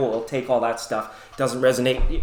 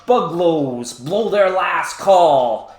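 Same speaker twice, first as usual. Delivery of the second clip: slow, drawn-out dramatic poem reading; poem recital; 5 semitones higher, 5 dB louder, slower than usual. Acted. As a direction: recite it slowly like a grand poem. Reads the weather poem.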